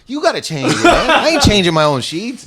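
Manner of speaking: high-pitched mock outrage, half laughing; retold indignation. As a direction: argue back, pitch jumping up. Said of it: "I was so mad".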